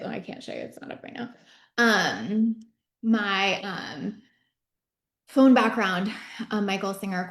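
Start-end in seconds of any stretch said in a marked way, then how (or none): 0.0-1.3 soft whispered aside, mid-sentence. Real, not acted. Speaker aside to listeners, whispered. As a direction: drop into a whisper while searching. Aside while looking for phone.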